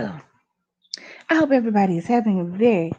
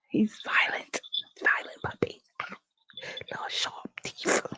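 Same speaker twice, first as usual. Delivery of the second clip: breathy unvoiced whisper about the puppy; 11 dB quieter than usual. Real, not acted. Whispering to viewers about the biting puppy. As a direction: keep it a hush. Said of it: "I feel like I should whisper".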